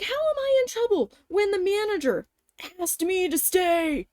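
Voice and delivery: high-pitched incredulous protest; indignant disbelief. Retold or imitated. Acted indignant argument.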